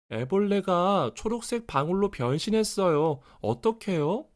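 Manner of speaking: put-on high, worried child voice; a boy anxiously asking mom; alarmed child. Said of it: "페르디가 물었어요". (놀란 아이 목소리)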